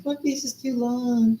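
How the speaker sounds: mocking complainer. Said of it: "all the people that say".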